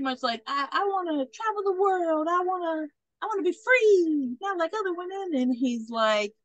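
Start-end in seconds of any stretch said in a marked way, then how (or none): 0.5-5.3 high, wistful heroine parody; mock dreamy. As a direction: imitate her yearning, pitched up. Retold woman declares her dreams.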